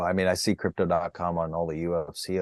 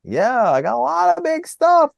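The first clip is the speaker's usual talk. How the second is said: loud, very high hyped-up voice; over-the-top high-pitched boast; mock excitement; 6 dB louder than usual. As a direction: ham up the big announcement. Hammed-up answer about upcoming plans.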